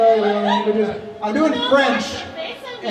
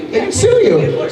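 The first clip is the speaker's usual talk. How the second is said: shrill mock outrage; 11 semitones higher, 4 dB louder than usual. Squeaky repeat.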